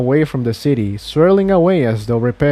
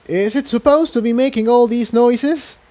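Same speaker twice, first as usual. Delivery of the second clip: much higher, nervous question; uneasy Incendia; 10 semitones higher than usual. Acted. Incendia asks about the noises, high and nervous. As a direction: worry over the rising alarms.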